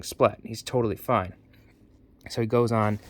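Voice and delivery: ordinary delivery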